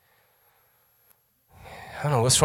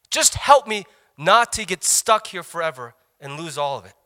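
louder, higher, pleading urgently; urgent plea; 7 semitones higher, 7 dB louder than usual. Retold or imitated. A man pleading with his pastor, urgent. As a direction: beg to be shown the way out. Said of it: "He's saying".

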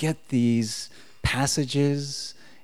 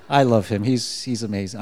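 ordinary talk, nothing marked

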